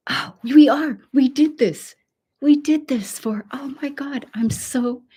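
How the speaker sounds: proud real celebration; excited. Real, not acted. Host thanking viewers for the milestone, excited.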